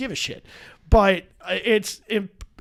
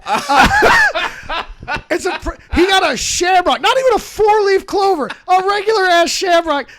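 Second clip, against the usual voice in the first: laughing; 10 semitones higher, 10 dB louder than usual. Real, not acted. Laughing at friend's tattoo.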